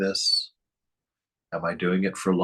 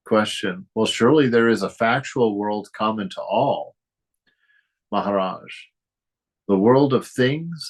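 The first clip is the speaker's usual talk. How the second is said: reading a text aloud; 4 semitones higher, 3 dB louder than usual. Acted. Teacher reads a Maharaj dialogue to students, solemn reading. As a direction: read the dialogue like a revered scripture.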